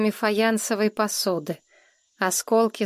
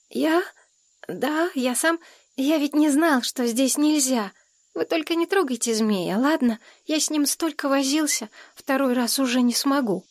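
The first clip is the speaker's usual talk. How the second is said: put-on higher-pitched child's voice, pleading; timid little boy; 5 semitones higher than usual. A narrator's reading. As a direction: plead like a worried small boy.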